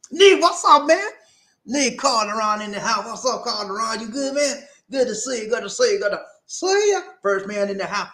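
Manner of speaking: high-pitched, hyped shout-out; excited greeting. Real, not acted. Greets a viewer joining the stream.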